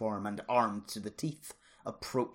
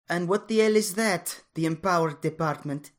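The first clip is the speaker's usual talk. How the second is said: high, louder wary question; suspicious; 8 semitones higher, 9 dB louder than usual. A narrator's reading. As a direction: challenge the strangers with distrust. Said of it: "she asked suspiciously".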